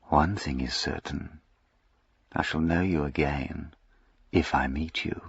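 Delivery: the duke to Jack, a silky soft threat. A narrator's reading. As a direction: let it slide out soft and silky. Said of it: "very, very silkily".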